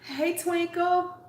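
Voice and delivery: drawn-out high hey; high sing-song greeting. Real, not acted. Calling out to a live viewer, high sing-song hey. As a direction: stretch the hello up high and slow.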